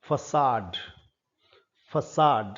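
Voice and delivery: फसाड said as "fa-sahd"; French pronunciation. Shown as a demonstration. Teacher drilling façade for students, clear French word. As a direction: stress the final syllable of फसाड. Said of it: "इसका pronunciation थोड़ा different है".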